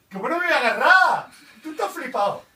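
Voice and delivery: loud, high, exasperated protest; indignant protest. Real, not acted. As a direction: argue back, fed up and loud. Protesting at the instruction.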